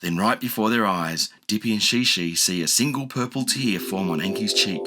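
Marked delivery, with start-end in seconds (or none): none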